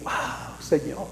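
unvoiced whisper of wonder; a whispered wow to the Lord; whispered awe. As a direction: breathe it out in amazement. Believer amazed at sandals.

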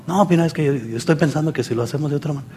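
very soft, hushed reasoning. Acted. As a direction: drop right down so they must strain to hear. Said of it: "Hasta tiene que bajar la voz".

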